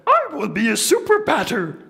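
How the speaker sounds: high, loud, proud puppet voice; Grover voice. Acted. Grover promising Truman to hit well, high proud puppet voice. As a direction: Grover boasting bravely. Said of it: "letting me do the voices".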